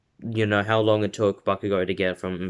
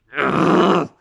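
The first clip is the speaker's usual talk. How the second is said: loud, high growl of frustration; imitated furious grunt; angry growl; 8 semitones higher, 7 dB louder than usual. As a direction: act out rising anger without words. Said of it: "he starts getting angry".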